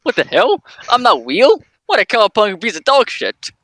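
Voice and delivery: high-pitched, indignant character voice; mock outrage. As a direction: squawk in pretend offence. Joking in the character's voice.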